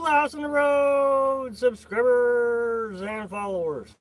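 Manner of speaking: long drawn-out, high hello to the crowd; calling out greeting. Real, not acted. Streamer greeting subscribers and followers, drawn-out high call. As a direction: holler it out slowly, stretching the words.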